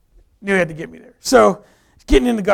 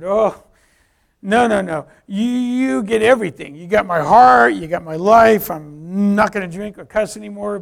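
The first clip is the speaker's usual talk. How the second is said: louder, stretched-out objection; protesting; 3 semitones higher, 8 dB louder than usual. Retold or imitated. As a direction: plead your case emphatically. Bargaining with God, retold.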